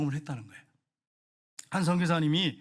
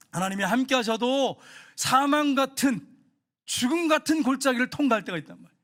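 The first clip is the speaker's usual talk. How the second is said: higher, louder sermon cry; full-force preaching; 7 semitones higher, 5 dB louder than usual. Real, not acted. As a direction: preach it out to the congregation.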